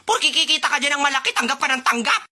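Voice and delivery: high-pitched, hammed-up telling-off; mock indignant scolding. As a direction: scold the vendor in a high, exasperated voice. Acted scolding of the vendor.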